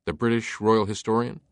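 usual speaking voice

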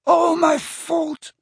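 high, slow tearful wail; anguished, wailing confession; sobbing. A narrator's reading. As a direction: sob out the guilt, drawn out. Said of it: "he sobbed".

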